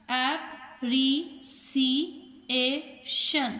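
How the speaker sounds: appreciation in five drawn-out beats, ap, pre, ci, a, tion; teacher sounding out ap—pre—ci—a—tion; split into syllables. Shown as a demonstration. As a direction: say appreciation very slowly with a gap after every syllable. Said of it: "चार vowel से ज़्यादा की sound".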